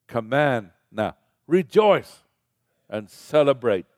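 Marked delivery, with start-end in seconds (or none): 1.5-3.8 rejoice, higher and louder; a preacher's exultant cry; rousing call. Real, not acted. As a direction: proclaim it to the crowd. Preacher exhorting congregation.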